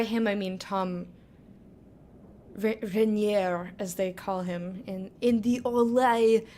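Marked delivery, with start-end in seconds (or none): 2.6-3.7 bad French accent. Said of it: "that was the worst French accent I've possibly ever done". 5.2-6.5 bad French accent. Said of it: "that was the worst French accent I've possibly ever done".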